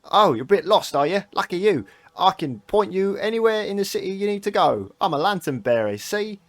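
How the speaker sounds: high, loud lantern-bearer lad; cheeky little guide with an old man's accent; young boy, oddly old-mannish. Acted. Lantern boy offering a lost stranger directions, high young urchin voice. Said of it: "young boy with a weirdly old man accent".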